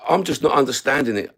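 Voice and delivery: ordinary talk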